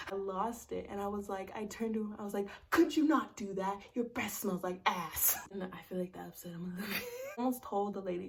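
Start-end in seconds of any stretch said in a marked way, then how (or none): 2.7-5.4 fed-up snap. Retold or imitated. Snapping at the ex on the plane.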